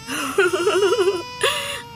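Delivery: high giggle on the phone; coy giggle. Acted. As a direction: giggle flirtatiously. Rajjo flirting on phone. (Phone पर शरमाकर हँसना)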